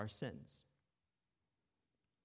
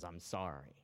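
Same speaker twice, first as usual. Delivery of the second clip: lower, grudging little sorry; begrudging apology; 5 semitones lower, 4 dB quieter than usual. Retold or imitated. Son to sister, forced to apologize, lower and begrudging. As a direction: apologize like you don't mean it, eyes on the floor. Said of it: "he marched upstairs begrudgingly".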